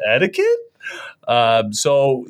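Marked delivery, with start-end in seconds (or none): 0.1-0.6 questioning echo. Pretend. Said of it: "mock questioning echo".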